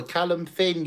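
normal talking voice

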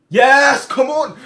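high, very loud burst of joy; triumphant shout. Real, not acted. Player cheers Kaká on in the match, triumphant yell. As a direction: explode with real excitement. Celebrating penalty award.

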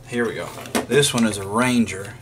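ordinary talk

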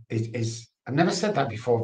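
ordinary talk

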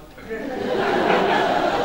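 breathy laugh. Acted. Laughs at the question.

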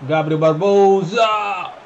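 slow, stretched-out name call; excited shout. Real, not acted. Commentating a big chance.

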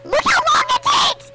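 high-pitched exasperated wail; whining shout. Real, not acted. Complains about slow trap.

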